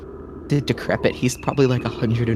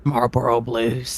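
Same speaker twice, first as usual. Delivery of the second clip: a gruff, impatient circus ringmaster scolding; slower than usual. Acted. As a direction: grumble at the late performer.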